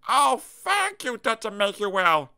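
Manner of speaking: high, slow grateful voice; grateful small character. Acted. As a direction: thank him slowly and sweetly.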